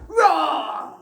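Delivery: loud stern roar; giant roar. Shown as a demonstration. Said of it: "you have a stern roar".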